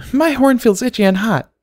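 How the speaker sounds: put-on very high whiny little girl voice; high childish complaint; complaining filly. A narrator's reading. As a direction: whine it like a fidgety child. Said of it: "complained Dinky".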